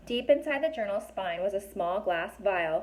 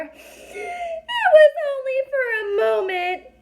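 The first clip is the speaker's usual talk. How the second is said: very high, loud, tearful fairy voice; tiny fairy crying as she explains; 10 dB louder than usual. A narrator's reading. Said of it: "and cried some more".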